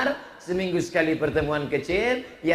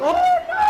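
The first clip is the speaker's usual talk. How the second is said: very high, loud comic howl; mock wail; 7 dB louder than usual. Mother scratching walls at home after parting, mock wailing. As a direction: let out a hammed-up, long shrieking wail.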